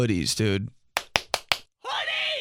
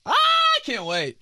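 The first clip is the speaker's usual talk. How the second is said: excited shout; slower than usual. Retold or imitated. Listeners about their hookup stories, shouting excitedly. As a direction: squeal with excitement. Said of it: "they're all gonna be like".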